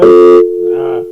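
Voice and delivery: ordinary talk, nothing marked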